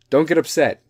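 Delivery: loud, firm order; stern own voice. Shown as a demonstration. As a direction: say it bluntly, no coddling. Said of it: "I'm gonna do it for my voice".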